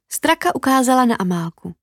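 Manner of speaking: ordinary delivery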